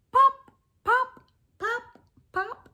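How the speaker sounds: high loud popping sounds; cork popping. Pretend. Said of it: "He can sound like a cork".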